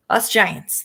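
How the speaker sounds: ordinary delivery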